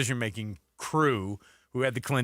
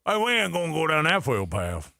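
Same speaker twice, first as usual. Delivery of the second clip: put-on thicker Louisiana accent; 3 semitones higher than usual. Host redoing the governor's quote, thicker Louisiana accent. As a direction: lean harder into the Louisiana accent. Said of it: "More like that".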